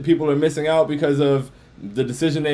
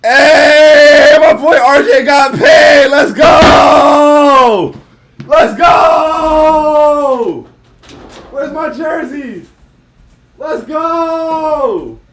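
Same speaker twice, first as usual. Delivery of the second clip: high, loud jubilant shouts; ecstatic shouting; 12 semitones higher, 13 dB louder, much slower than usual. Real, not acted. Streamer shouting with joy over RJ Barrett's payday. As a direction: yell it out in pure celebration.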